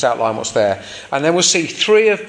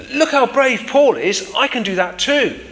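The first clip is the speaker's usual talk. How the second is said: high, lightly acted admiration; inspired admiration; 9 semitones higher than usual. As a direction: voice an inspired onlooker, pitched up. Voicing inspired church people.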